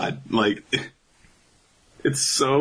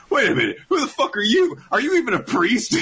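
high, quick mock protest; indignant crowd outburst. Crowd heckling the officiant, indignant outburst. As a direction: challenge him in hammed-up disbelief.

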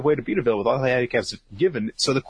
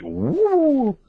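high woo; 3 dB louder than usual. Retold or imitated. Imitating joke sound.

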